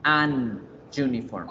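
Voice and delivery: an uniform held apart, "an... yuniform"; stuck pronunciation. Shown as a demonstration. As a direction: show an uniform snagging slowly between N and Y. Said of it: "la pronunciación se va a ver atascada".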